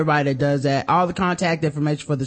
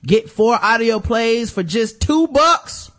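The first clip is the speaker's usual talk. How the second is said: hammed-up ad read; 6 semitones higher, 4 dB louder, slower than usual.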